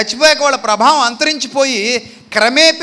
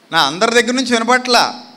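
ordinary talk

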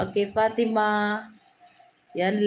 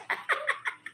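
breathy high giggle; 7 dB quieter than usual. Real, not acted. Reacting to a comment.

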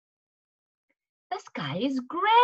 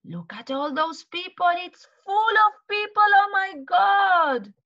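shocked gasp; 8 semitones higher, 4 dB louder than usual. Acted. Story crowd floods Winnie's garden.

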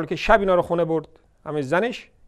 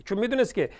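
usual speaking voice

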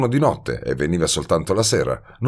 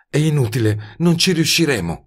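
higher female voice, worried; Betty's voice; 9 semitones higher than usual. A narrator's reading. (voce femminile più acuta, preoccupata)

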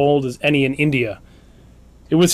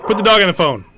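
demanding; 5 semitones higher than usual. Real, not acted. Host demanding the dog.

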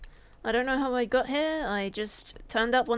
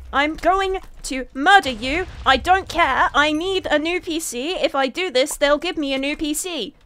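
fired-up shout; 3 semitones higher, 6 dB louder than usual. Real, not acted. Fired up mid-fight.

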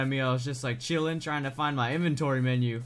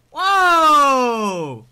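long, loud, high falling cry; amazed whoa; 11 semitones higher, 15 dB louder than usual. Real, not acted. Gamer awed by the game, amazed yell. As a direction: let out a big drawn-out whoa.

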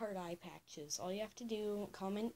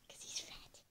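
whisper; 5 dB quieter than usual. Real, not acted. Sneaky plan about the pet.

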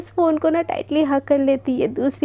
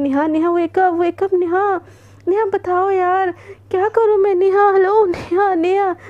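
calling out; 5 semitones higher, 4 dB louder, slower than usual. Acted. Waking sleeping friend on phone.

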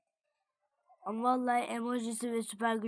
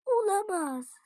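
mock dread; 9 semitones higher, slower than usual. Meteor falling in game.

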